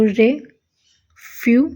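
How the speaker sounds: Refused drawn out slowly; slow drawn demo. Shown as a demonstration. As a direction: stretch refused. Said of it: "अगर refused लिखेंगे". (Refused खींचकर)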